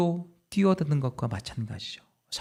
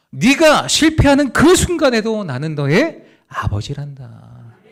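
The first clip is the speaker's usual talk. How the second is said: higher and louder, building to a cry of love; impassioned divine voice; 5 semitones higher, 8 dB louder than usual. Acted. The Spirit assures sinners, swelling impassioned proclamation. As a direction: proclaim the Father's love with rising force.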